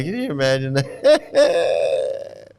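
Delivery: slow words tipping into a laugh; breaking into laughter. Real, not acted. Commentator picturing drinking guru foot water, breaking into laughter. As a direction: let a real laugh take over the end.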